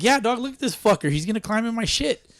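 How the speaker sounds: high, put-on complaining voice; high-pitched mock whine. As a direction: squeal it like you're scared of a mouse. Acted high panicky reply about mouse.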